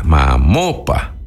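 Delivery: "Mamopa" slow, low, accent on the O not the A; slow stress on O. Shown as a demonstration. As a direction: draw out "Mamopa", hitting the O. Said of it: "acento en la O y no en la A". ("Mamopa" lento y grave, acento en la O)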